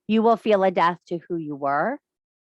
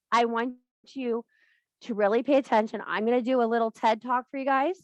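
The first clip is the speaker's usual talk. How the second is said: ordinary delivery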